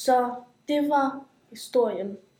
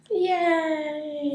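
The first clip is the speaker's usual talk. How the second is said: put-on cheering kids; 5 semitones higher than usual. Children playing outside.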